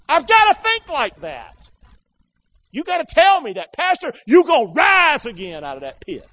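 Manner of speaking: high, loud, shouted sermon; full-force preaching. Real, not acted. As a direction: cry it out to the whole congregation.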